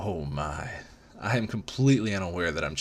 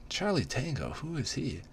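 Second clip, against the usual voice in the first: surprised; 8 semitones higher, 4 dB quieter than usual. A narrator's reading. Startled inner question.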